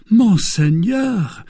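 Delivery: high, drawn-out embarrassed exclamation. A narrator's reading. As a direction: protest awkwardly, at a loss. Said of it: "with an embarrassed air".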